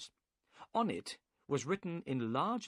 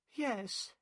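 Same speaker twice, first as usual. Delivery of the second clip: higher voice for the boatman's wife; lightly voiced woman, curt yes; woman's voice; 12 semitones higher than usual. A narrator's reading.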